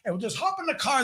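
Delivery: mock insistent host; pushy stranger voice. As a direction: wheedle them into the van. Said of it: "tell his voice in a way of how he made me feel".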